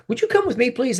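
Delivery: high, lightly imitated woman's request; polite casting director. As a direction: pitch it up as a courteous summons. Retold casting director summons him.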